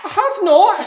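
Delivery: high, loud protest; indignant denial. Acted. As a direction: yell back in denial. Children quarrel over the river.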